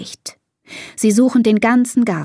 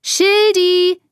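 high, held-out call; loud calling; 11 semitones higher, 3 dB louder than usual. A narrator's reading. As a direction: call the lost pet across the garden. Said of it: "ruft sie ganz laut".

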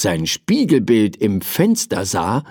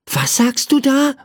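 put-on high-pitched curious little boy. A narrator's reading. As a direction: ask it in a high, wondering child's voice. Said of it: "fragte ihn Michael neugierig". (neugierige hohe Kinderstimme)

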